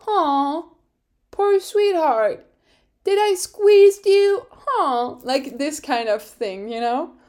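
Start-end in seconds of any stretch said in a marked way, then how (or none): 0.1-5.2 put-on high, slow, loud baby-talk cooing; mock pity. [name] mocking Pierre after the shove, in sarcastic baby-talk cooing. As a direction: coo at him like a hurt baby.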